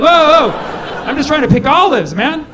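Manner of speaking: loud alarmed whoa with a laugh; startled farmer. Retold or imitated. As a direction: back off in alarm.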